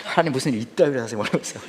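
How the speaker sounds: ordinary delivery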